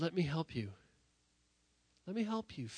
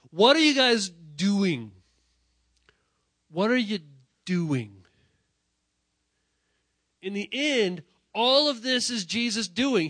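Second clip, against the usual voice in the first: loud, higher, slow preaching; full-force preaching; 6 semitones higher, 12 dB louder, slower than usual. Acted. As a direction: hammer the question home to the congregation.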